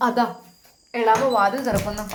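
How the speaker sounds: usual speaking voice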